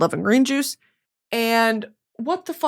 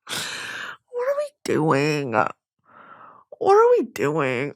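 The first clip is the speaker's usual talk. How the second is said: lower, very slow and quiet; low slow mutter; 6 semitones lower, 5 dB quieter, much slower than usual. Acted. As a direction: drag it out slowly, quiet and low. Slow self-addressed low mutter.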